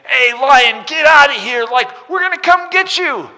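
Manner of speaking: very high, loud mocking kid shout; kids yelling at lion. Preacher voicing punk kids yelling at the lion, high shrill jeers. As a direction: jeer like a pack of shepherd boys. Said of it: "they start yelling and banging".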